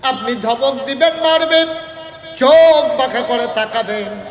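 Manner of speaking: loud, high, drawn-out protest; impassioned protest. Retold or imitated. Wife answers the Prophet, loud slow protest. Preacher acts out wife's protest.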